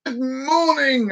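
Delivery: high, long sing-song greeting; cheery greeting. Acted. Worker greeting boss in the morning, cheery. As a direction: stretch it out high and chirpy. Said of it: "going into my boss's room in the morning and going".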